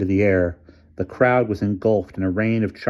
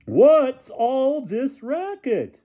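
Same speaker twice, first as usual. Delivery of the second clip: bellowing; much slower than usual. A narrator's reading. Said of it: "bellowed Coach Flynn".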